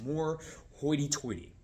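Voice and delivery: slow, mock-refined delivery; hoity-toity. Shown as a demonstration. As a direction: draw it out with reserved, professional poise. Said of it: "you become more serious, more professional".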